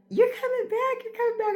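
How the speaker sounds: high sing-song call after someone. Retold or imitated. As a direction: call it out high and bright like a cheerful hiker. Hikers calling after her.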